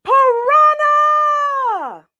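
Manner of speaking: long, high, sustained scream; yelled alarm. Acted. Diners spotting a piranha, screaming in alarm. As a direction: shriek the warning across the lake. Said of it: "That's what you yell".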